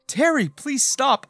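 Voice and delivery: high, loud, slow desperate plea; dramatized cry for mercy; begging. A narrator's reading. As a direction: plead urgently for it to stop. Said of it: "I begged".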